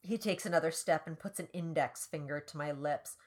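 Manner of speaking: ordinary delivery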